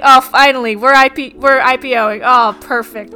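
loud, gloating voice; mock smug CEO. Mocking the Uber founder, loud smug gloat. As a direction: crow it like a smug rich founder. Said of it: "He's just like".

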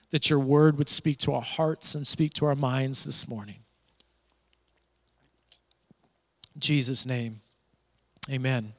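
subdued, even praying voice; hushed, steady pastoral prayer. Real, not acted. Leads a prayer.

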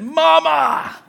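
sudden loud, high yell; shouted out. Retold or imitated. Pastor shouting instead of cursing after cutting his thumb, shouted out. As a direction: yell it out like a cry of pain. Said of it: "And I shouted out".

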